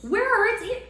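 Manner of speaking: high, bright mock question; playful. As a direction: ham up the question for kids. Echoing the child's question playfully.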